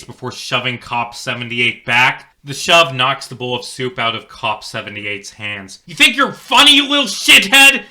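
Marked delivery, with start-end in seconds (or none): 5.9-7.8 high, loud furious yell. Acted. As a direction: scream at the boy in rage. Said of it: "he yells".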